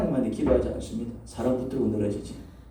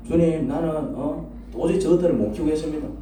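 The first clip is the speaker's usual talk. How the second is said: exasperated complaint; 6 semitones higher than usual. Acted. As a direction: act out a fed-up pastor complaining. Acted angry complaint to God. (답답해서 하소연하듯 점점 높여서)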